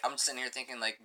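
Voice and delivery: usual speaking voice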